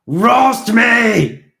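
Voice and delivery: put-on high, loud drunken yell at the sun; wild drunken bellow; drunken challenge shout. A narrator's reading. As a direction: scream up at the sky, daring it. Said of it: "he challenged it".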